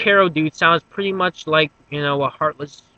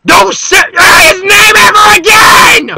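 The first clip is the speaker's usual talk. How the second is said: furious scream; 15 dB louder, slower than usual. Acted. Furious reaction to hearing name.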